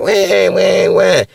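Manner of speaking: high mock baby wail; baby crying wail. Preacher imitating a hungry baby crying, high mock wail.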